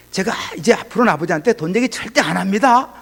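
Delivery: high-pitched, self-righteous mock outburst; huffing indignation. Son vowing never to ask his father for money, indignant. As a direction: re-enact a proud son huffing back. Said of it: "뭐가 잘났는지 막 씩씩거리면서".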